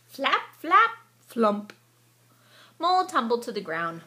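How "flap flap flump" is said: flapping sound effect. A narrator's reading.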